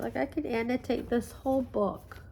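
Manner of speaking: normal talking voice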